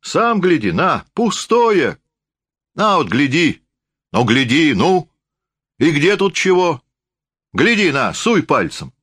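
high, emphatic rustic urging; drunken forester, insisting. A narrator's reading. (лесник настойчиво, высоко уговаривает)

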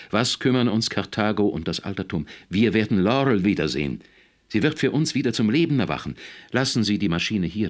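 laut und hoch drängend; schreiend. A narrator's reading. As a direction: aufgeregt flehen, mit gehobener Stimme. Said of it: "schrie Misses Potterly". (leidenschaftlich ausgerufen)

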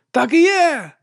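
high, excited confirmation; exclaiming. A narrator's reading. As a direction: cry out in recognition. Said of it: "вигукнув один із сутенерів".

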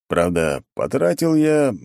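plain reading voice

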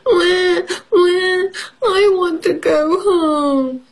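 high, slow whimpering wail; baby mouse crying. Acted. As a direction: cry for home like a lost baby mouse. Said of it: "cries Baby Mouse".

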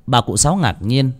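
plain reading voice

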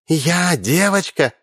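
put-on can't believe she's called a girl; surprised. Alina, surprised at being called a girl, slow high question. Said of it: "удивилась Алина".

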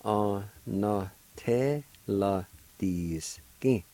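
Onateladiskin stretched out very slowly, syllable by syllable; very slow pronunciation. Shown as a demonstration. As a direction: drag out Onateladiskin slowly for the learner. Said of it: "is pronounced like this".

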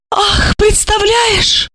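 high, slow drawn-out sigh of a word; high slow sighing exclamation. A narrator's reading. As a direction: sigh it out theatrically. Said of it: "вздохнула Ольга".

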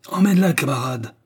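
ordre rugi, plus haut et fort; rugissement. A narrator's reading. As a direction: Bulba aboie l'ordre au camarade. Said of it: "rugit Bulba". (ordre rugi avec force)

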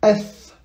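Eth ending in a th with the tongue between the teeth; Arabic tha with sukun, dental th. Shown as a demonstration. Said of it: "on met bien la langue entre les dents".

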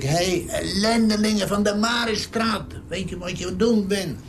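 high-pitched mock yell of abuse; retold angry shout. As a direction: re-enact cursing them out, voice pitched high. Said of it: "En dan niet zo zachtjes".

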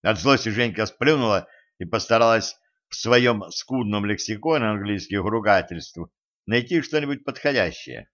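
ordinary delivery, nothing marked

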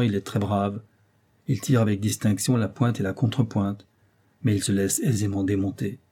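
ordinary delivery

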